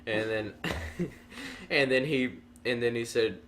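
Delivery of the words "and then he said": ordinary talk, nothing marked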